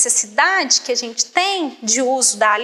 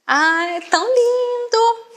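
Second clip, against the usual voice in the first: mock gushing; 8 semitones higher than usual. Imitating someone admiring an object, mock gushing. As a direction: coo it like over a cute thing.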